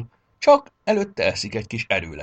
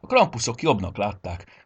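usual speaking voice

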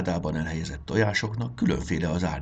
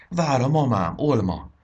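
high and loud with delight; the girl telling her friend, bursting with joy; overjoyed; 6 semitones higher, 6 dB louder than usual. Acted. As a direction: burst with happiness.

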